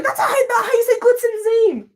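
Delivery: high-pitched mock yell of a friend; imitated yelling. Friend correcting a name's pronunciation, mock yelling. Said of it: "My friend was yelling at me".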